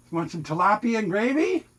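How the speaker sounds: high, rising offer to the cats; high coaxing pet voice; pet talk. Real, not acted. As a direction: sing out the menu to the cat, pitch up.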